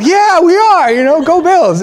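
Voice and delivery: high, loud and bubbly; cheery fan reply. Retold or imitated. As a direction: go Bills with big bright excitement.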